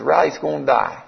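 even, matter-of-fact answer; retold doctor's level statement; sober confirmation. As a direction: confirm it gently and plainly. Vet's plain confirmation.

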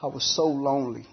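normal talking voice

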